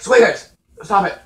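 loud call to the cat. Real, not acted. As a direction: shout the pet's name across the room. Calling the cat at door.

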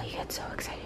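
hushed, breathy whisper. Real, not acted. Owner whispering about her excited dog during pedicure, soft whisper. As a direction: let it fall to a whisper. Aside while petting dog.

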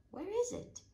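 high, loud puzzled question; where did it go, alarmed; dismayed surprise. A narrator's reading. Spring has vanished, he searches.

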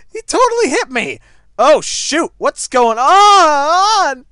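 high, loud outburst, last word stretched; shocked yelling. Real, not acted. Player just hit in game, shocked yelling. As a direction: yell in disbelief, dragging out the end.

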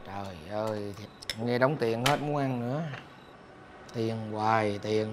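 low, soft, drawn-out complaint; weary grumble. Acted. Father asked for school fees at dinner, weary grumble. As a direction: let it out slowly and quietly, worn down.

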